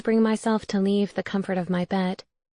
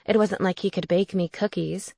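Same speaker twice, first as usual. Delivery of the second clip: ordinary delivery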